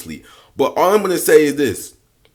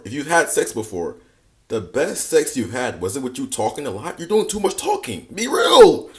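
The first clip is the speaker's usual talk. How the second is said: usual speaking voice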